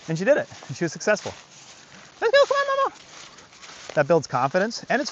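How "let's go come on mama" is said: high sing-song call. Real, not acted. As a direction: sing the dog along. Calling dog along.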